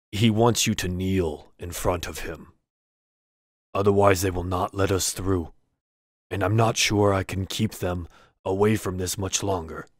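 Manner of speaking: a hint of a nervous orc interpreter; lightly voiced orc. Orc guide relays the shaman's demand to the party, lightly voiced.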